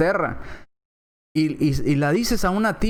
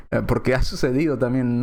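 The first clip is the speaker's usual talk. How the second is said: usual speaking voice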